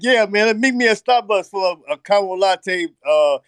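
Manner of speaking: loud laughing street-tough impression; laughing mock thug voice. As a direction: playfully act out the stick-up guy. Acting out the robber's invitation.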